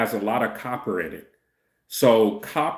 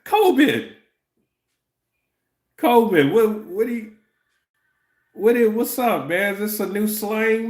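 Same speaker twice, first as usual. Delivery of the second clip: much higher, louder put-on dude voice; mock hip slang voice; 10 semitones higher, 3 dB louder than usual. Mocking the commenter's strange slang in a high put-on dude voice. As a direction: ham up a confused street-slang guy.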